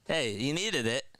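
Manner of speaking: ordinary talk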